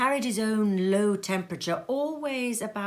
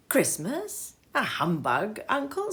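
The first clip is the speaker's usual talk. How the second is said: incredulous question; 3 semitones higher than usual. Acted. Nephew incredulous.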